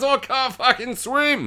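high slow panicked wail; panicked high cry. Retold or imitated. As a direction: cry out that you can't swim. Man panicking in water.

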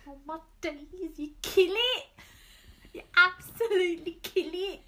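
high-pitched, loud imitation of a friend's cheerful outbursts; imitating Tasha. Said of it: "I like Tasha's one".

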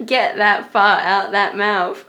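slow, loud and giggly; laughing. Real, not acted. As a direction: draw it out while cracking up. Laughing at fart mouth.

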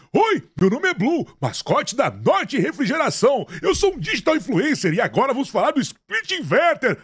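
cartoon bear voice. Shown as a demonstration. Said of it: "eu faço a voz do urso".

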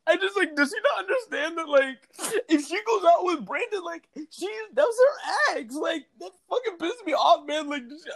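high-pitched mock whine of a jealous friend; imitating a jealous guy. Friend calling to complain about his girlfriend, high mock whine. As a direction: squeak it out like a petty boyfriend. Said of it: "calls me later to be like".

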